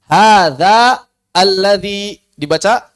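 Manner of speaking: hadza alladzi chanted slowly, the vowels drawn out; slow Arabic recitation. Shown as a demonstration. Teacher models how hadza alladzi is read. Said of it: "recites the Arabic slowly".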